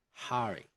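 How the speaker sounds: "Hurry" as "huh-ree"; unblended R. Shown as a demonstration. Teacher returns to British "Hurry", R unblended. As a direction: clear vowel before the R in "Hurry". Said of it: "don't blend R with a vowel".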